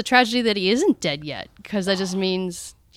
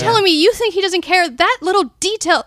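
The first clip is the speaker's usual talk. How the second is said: higher-pitched, emphatic disbelief; acted indignant retort; 8 semitones higher, 4 dB louder than usual. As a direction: throw it back at the doubter, incredulous.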